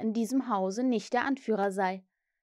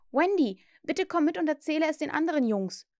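put-on higher, eager boy's plea; urging boy; 7 semitones higher, 3 dB louder than usual. A narrator's reading. Peter begs Wendy to join the boys, urging. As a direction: urge her with raised pitch.

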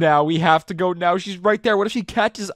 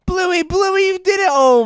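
much higher, louder cheer; excited shout; 12 semitones higher, 6 dB louder, slower than usual. Real, not acted. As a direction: shout with joy at the win. Friend finally escapes.

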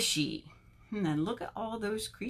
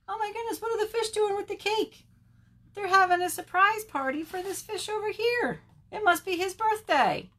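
high-pitched delighted surprise; amazed excitement; 10 semitones higher than usual. Acted. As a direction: react high and amazed for small children. Reader reacts to the surprise.

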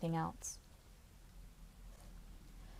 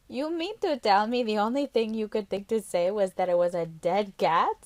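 Carla's put-on voice, louder, laughing disbelief; incredulous Carla; 2 semitones higher, 5 dB louder than usual. Carla teasing the girl about her lie, incredulous and louder. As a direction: tease her for the dead-cat lie.

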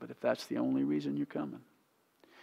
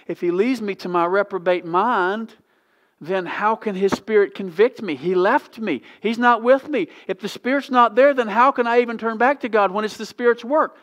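higher and louder, a doubter's pleading argument; loud anguished protest; 9 semitones higher, 11 dB louder than usual. Retold or imitated. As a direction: cry out the doubter's objection to God. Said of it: "You say".

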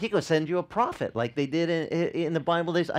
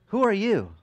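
put-on a touch higher, louder nosy kid; playground kid asking; 7 semitones higher, 5 dB louder than usual. Kids quiz little Jesus, a slightly higher, louder pushy voice. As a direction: lightly play a pushy child.

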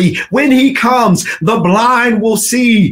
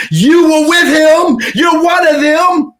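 much higher, loud accusation; accusing shout; 8 semitones higher, 3 dB louder than usual. Acted. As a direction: expose him. Servant girl accuses.

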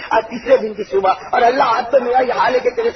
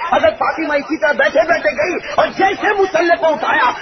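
soaring sermon climax; 8 semitones higher than usual. Acted. Preacher to the crowd, rising ecstatic cry. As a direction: carry the crowd to rapture.

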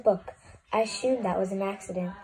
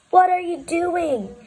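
outraged disbelief; 7 semitones higher, 4 dB louder, slower than usual. Acted. Book character reacts to page turn.

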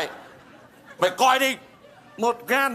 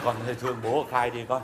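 very deep, soft lament; low mournful pleading; 10 semitones lower, 6 dB quieter, faster than usual. Acted. Father begging daughter to confess, low and mournful. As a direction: sink into a grave, hushed plea.

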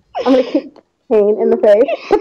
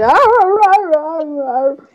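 mock dog howl; 4 semitones higher, much slower than usual. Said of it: "They'd be howling".

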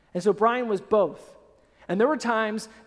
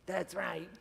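very soft, low self-satisfied aside; cocky bear cub; 6 semitones lower, 15 dB quieter than usual. Acted. Cub after the jaguar flees, quiet cocky aside. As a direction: quietly take credit as the little cub. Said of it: "he turns around, he's like".

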